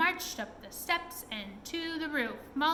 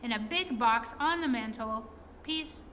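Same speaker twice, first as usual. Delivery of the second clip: usual speaking voice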